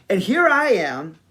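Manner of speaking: ordinary delivery, nothing marked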